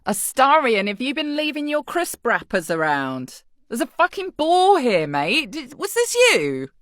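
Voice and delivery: mock-cross, high-pitched complaint; exasperated scolding. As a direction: tell off a littering companion. Scolding companion over litter.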